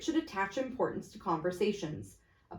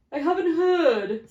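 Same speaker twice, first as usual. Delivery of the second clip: higher, slow bored reply; yawning fat man; 9 semitones higher, 8 dB louder than usual. Acted. Fat merchant yawning his reply, higher and slow. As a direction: drawl it, bored.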